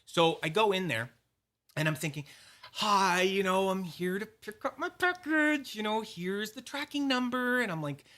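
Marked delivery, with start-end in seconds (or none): 2.7-7.6 high-pitched mock-polite greeting; hammed-up politeness at the counter; overly polite. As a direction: put on an extra-polite customer voice, pitched way up. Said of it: "So you have to be polite".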